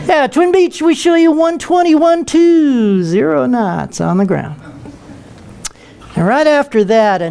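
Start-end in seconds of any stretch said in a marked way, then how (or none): 0.1-4.6 high, sweet start, then slow and drawn out; singsong controller read, slow on the numbers; same controller voice. Retold or imitated. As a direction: sing the speed back like he's Air Force One. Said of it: "talk to him like he's Air Force One".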